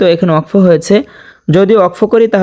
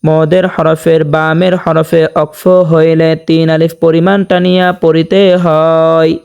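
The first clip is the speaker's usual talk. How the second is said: ordinary delivery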